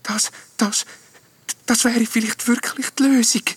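very high, faltering ghost voice; halting little ghost. A narrator's reading. Tearful ghost searching for the right word, halting. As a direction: grope for the words in a high voice. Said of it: "ringt nach dem richtigen Wort".

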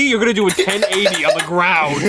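high, loud disbelief; mock him in disbelief; incredulous. Real, not acted. Teasing disbelief.

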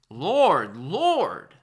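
loud, high and pleading; emphatic cry to be recognised; passionate plea. Shown as a demonstration. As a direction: cry out the name like a desperate believer. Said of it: "It's very passionate".

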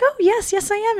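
high, melting reply; imitated charmed yes; answering a small child. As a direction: answer a little fan in a high, tender voice.

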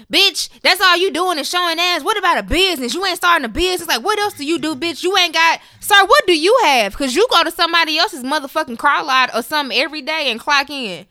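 high, loud scolding tirade; heated, acted-out telling-off; mock indignant rant. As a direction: fire off challenges like an exasperated scold. Voices critics, snaps back at them.

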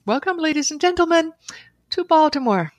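a hint of the pilot over the intercom; imitating the announcer. The cabin announcement after landing, lightly imitated announcer. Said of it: "and they announced".

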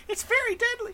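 very high, squeaky cartoon voice; squeaky fairy voice. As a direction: chirp the warning like a tiny fairy. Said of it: "As Fairy says".

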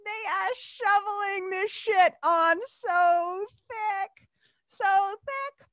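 high, slow exaggerated groan of disbelief; mock disgust. Host mocking the cartoon's sappy rescue, high and slow. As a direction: overplay your annoyance at the cliché. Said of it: "I would be like".

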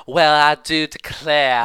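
drawn-out, loud Southern belle; mock a Southern belle's slow, hammy accent. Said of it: "Kim Little does a Southern Belle".

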